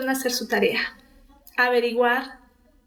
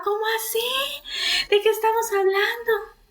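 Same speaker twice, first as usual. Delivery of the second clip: retold tearful outcry; 10 semitones higher than usual. Other sister who had no idea, retold tearful outcry. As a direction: imitate a crying sister in a thin high voice. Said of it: "ella gritaba y lloraba".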